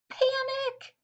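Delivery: very high frightened turkey yelp; mild mock panic, pitched very high; panicked cry. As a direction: squeal in sudden terror. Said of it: "He was really scared".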